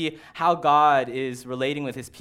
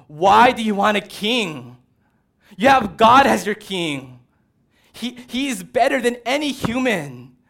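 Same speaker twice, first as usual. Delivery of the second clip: loud, higher, exasperated plea; frustrated shout; 7 semitones higher, 10 dB louder than usual. Retold or imitated. Preacher shouting at the Israelites, frustrated loud plea. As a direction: shout it in disbelief. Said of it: "there's this part of me that wants to shout".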